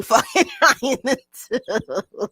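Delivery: laughing through the words. Real, not acted. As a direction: breaks into a laugh. Laughs mid-sentence.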